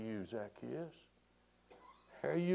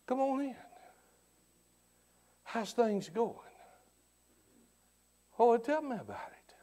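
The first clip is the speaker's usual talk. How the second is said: soft, inviting voice of a listening God; God welcoming a visitor in, soft and inviting; 4 semitones higher, 7 dB quieter than usual. Acted. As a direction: welcome the visitor gently, like a host. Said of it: "And to hear Him say".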